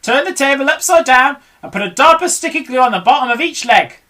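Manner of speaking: high loud commands; shouted orders. Acted. Mugglewump shouts orders to the animals. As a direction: direct the crew boldly. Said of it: "shouted Mugglewump".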